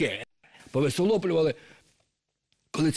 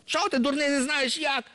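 much higher, mock-impatient snake girl; squeaky scolding. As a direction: scold him in a shrill high pitch.

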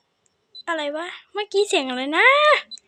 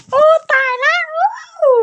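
oh no; 7 semitones higher, 4 dB louder than usual. Real, not acted. Sudden in-game death.

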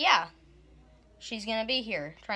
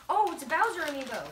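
excited; 7 semitones higher, faster than usual. Real, not acted. Unboxing a surprise gift.

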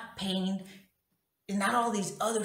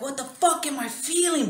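high, louder reenacted outcry; anguished anger; 8 semitones higher, 5 dB louder than usual. Woman retelling her shock at the feeling, anguished anger. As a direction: cry out as if hit by pain. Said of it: "this intense feeling of anger".

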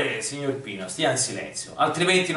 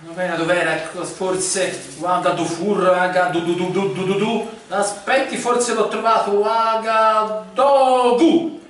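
frantic excitement; 6 semitones higher than usual. Acted. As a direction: panic to find the answer. Frantic search for answer.

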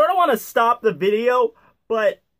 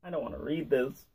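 low, very soft, quick reluctance; reluctant mumble; 11 semitones lower, 14 dB quieter, faster than usual. Real, not acted. Reader not wanting to read on, reluctant mumble. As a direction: say it small and low, hurried.

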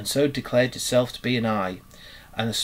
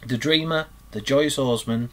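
usual speaking voice

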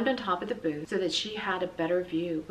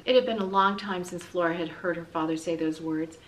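usual speaking voice

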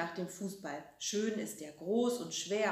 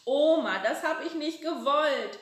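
higher, louder wailing; crying; 7 semitones higher, 7 dB louder than usual. A narrator's reading. Frieder cries to grandma about the window, wailing. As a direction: wail it through tears. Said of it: "heult der Frieder los".

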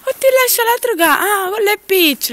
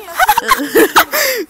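high laugh; 11 semitones higher, 7 dB louder than usual. Real, not acted. Laughing at the animals.

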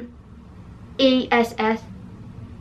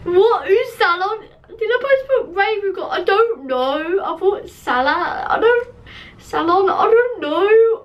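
baffled disbelief; 8 semitones higher than usual. Real, not acted. Baffled by her own misspelling.

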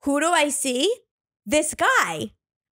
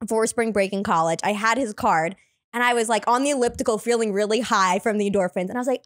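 ordinary talk

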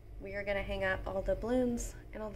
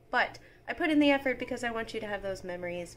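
ordinary delivery

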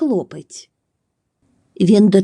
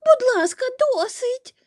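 squeaky, much higher begging; high pleading boy. Acted. Boy begging the overflowing pot, squeaking high. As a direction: plead like a panicked little boy. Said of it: "благав хлопчик".

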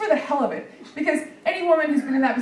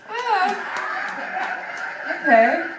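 startled reaction; 9 semitones higher, much slower than usual. Retold or imitated. Comic reacts to his sister's news, startled. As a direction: caught completely off guard. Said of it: "And I'm like".

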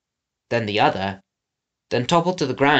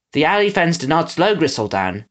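ordinary delivery

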